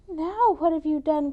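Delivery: high, loud alarmed cry. Acted. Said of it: "cried Bambolona".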